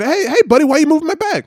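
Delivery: high mock challenge; hey buddy, confronting; aggressive guy. Locker room guy confronting him, high-pitched. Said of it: "If he would've said".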